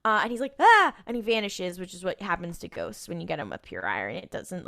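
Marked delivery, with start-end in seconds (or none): 0.6-0.9 short high, loud cry of pain; hammed-up ghost scream, cut short; mock pained yelp. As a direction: yelp as if suddenly stabbed. Ghost stabbed with iron.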